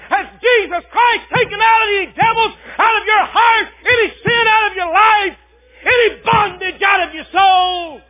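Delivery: high, loud revival shout; fiery preaching. Acted. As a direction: thunder it from the pulpit. Preaching at full force.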